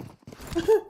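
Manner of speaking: laugh. Real, not acted. Laughs after dying.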